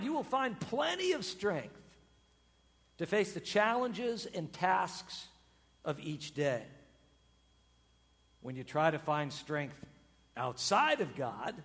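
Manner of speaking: normal talking voice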